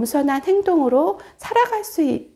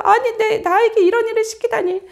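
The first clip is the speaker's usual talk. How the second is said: higher, louder indignant outburst; angry Haman; 8 semitones higher, 4 dB louder than usual. Acted. Haman furious at being ordered to honour Mordecai, indignant outburst. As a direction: sputter with outraged disbelief. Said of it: "하만은 더 화가 났어요".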